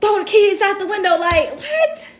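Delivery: high, loud and fast, ending in a laugh; incredulous mock order. Retelling the officer's command, loud incredulous disbelief.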